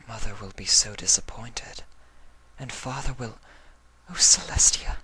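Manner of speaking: whispered, breaking off into despair; hushed dread as tears come; sad whisper. Acted. Octavia fearing her parents' disappointment, tearful whisper. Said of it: "sadness began to creep into Octavia's mind".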